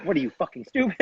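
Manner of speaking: retold angry demand; livid. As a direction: imitate an irate stranger without shouting. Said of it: "he's, he's livid".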